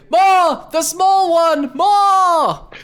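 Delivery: high shouting crowd voices. Acted. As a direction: yell it like a rowdy crowd. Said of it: "you hear the back row say".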